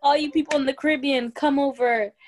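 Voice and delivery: lightly voiced royal summons; mock invitation to faraway subjects; the Queen. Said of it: "when the Queen was like".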